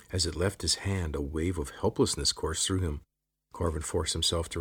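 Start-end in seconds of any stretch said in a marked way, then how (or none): none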